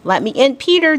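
high, light fairy voice; soft bell-like voice. A narrator's reading. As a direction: ring it out softly like a little bell. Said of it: "in a softly bell-like voice".

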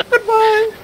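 very high mocking farewell; high squeaky goodbye.

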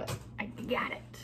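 unvoiced little win; whispered triumph. Real, not acted. Vlogger finally freeing the SD card, whispered triumph. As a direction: breathe out the victory.

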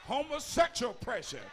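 preaching at full force. Real, not acted. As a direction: hammer each pressure out like a hellfire preacher.

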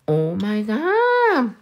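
slow, drawn-out awe after a gasp; awed amazement. Retold or imitated. Streamer retelling her reaction to gel that stays put, amazed. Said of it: "I'm like".

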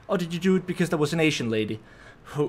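usual speaking voice

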